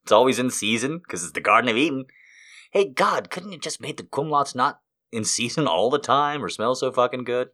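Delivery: ordinary talk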